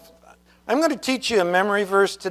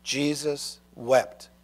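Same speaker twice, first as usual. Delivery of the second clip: "Jesus wept" softer and very slow, almost to himself; hushed repetition; 3 semitones lower, 8 dB quieter, much slower than usual. Shown as a demonstration. Preacher repeating the short verse to children, hushed. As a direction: let "Jesus wept" fall soft and slow. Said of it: "only two words".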